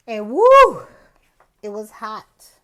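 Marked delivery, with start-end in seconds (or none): 0.3-0.8 high, loud whoop; whooped woo. Real, not acted. Back from a hot bike ride, whooping. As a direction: let out a big high woo.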